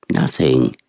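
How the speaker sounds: low, curt reply from a dazed woman; mother's terse answer. Acted. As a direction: brush them off in a low voice.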